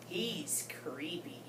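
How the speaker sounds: slow hushed whisper. Acted. Goldfish confiding to the class about Mister Bubbles, whispered. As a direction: lean in and breathe it like a secret.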